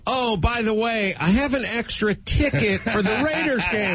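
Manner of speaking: high bragging dad voice; proud dad voice. Retold or imitated. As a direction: brag like a proud father at work. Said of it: "every Friday and go".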